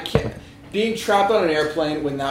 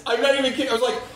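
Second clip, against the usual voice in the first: normal talking voice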